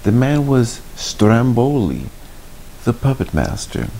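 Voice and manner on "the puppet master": ordinary delivery, nothing marked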